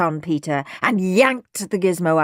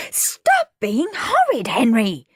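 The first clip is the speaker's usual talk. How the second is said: drawn-out, higher scolding; shouting; 5 semitones higher, slower than usual. A narrator's reading. Mum to Henry as the boys fight, shouting. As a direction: scold him with a raised voice. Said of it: "shouted Mum".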